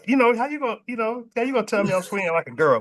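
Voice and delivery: high, playful protest; comically offended, high voice; mock indignation. Replaying his offended reaction to taunt.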